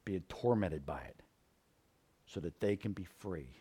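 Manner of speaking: soft, low prayer voice; closing prayer. Acted. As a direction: pray quietly to God.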